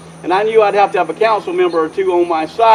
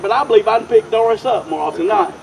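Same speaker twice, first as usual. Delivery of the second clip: normal talking voice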